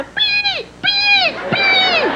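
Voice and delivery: three very high-pitched beeps; imitated dolphin squeaks. Dolphin answers stranded boy, high squeals.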